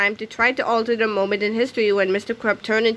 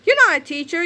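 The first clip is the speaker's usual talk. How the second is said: 1 shouted; 6 semitones higher, 4 dB louder, faster than usual. A narrator's reading. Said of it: "your first name shouted".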